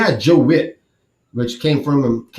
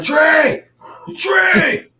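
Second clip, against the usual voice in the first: much higher, loud call across the stadium; yelling his son's name; 13 semitones higher, 3 dB louder than usual. Retold or imitated. Dad in the stands calling to his son on the field, loud shout. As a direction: shout the name from the top of the bleachers. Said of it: "Now I start yelling".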